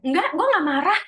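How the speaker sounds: angry denial. Acted. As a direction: deny being angry while clearly angry. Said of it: "Gitu, padahal marah". (menyangkal dengan marah)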